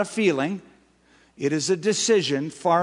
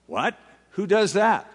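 incredulous; 5 semitones higher than usual. Acted. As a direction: ask it as if nobody could. Incredulous rhetorical question.

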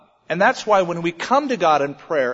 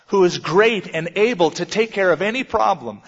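ordinary talk

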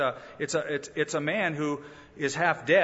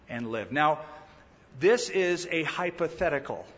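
ordinary talk

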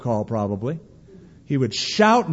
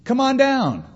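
higher, hammed-up call up the slope, fast at the end; mock giant's taunt; 7 semitones higher than usual. Goliath in the valley taunting Israel's army, mock higher call. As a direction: toss a bragging dare uphill, playfully. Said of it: "shouting up to the mountain".

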